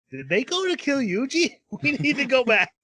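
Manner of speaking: high, alarmed, laughing through it; hammed-up panic; mock alarm. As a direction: sound like panicking students. Panicked students realise.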